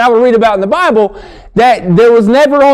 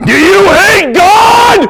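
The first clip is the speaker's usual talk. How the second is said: high, slow, loud crying yell; mock outrage; 9 semitones higher, 3 dB louder, much slower than usual. Teacher accusing the boy, hammed-up crying shout. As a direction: wail it like a scandalized teacher. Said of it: "Why don't you want to read".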